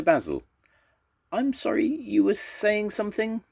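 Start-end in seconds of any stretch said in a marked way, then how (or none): 1.3-3.4 very high, polite inquiry; polite mother monster. A narrator's reading. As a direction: ask sweetly in a high-pitched voice. Monster mother turns to Basil.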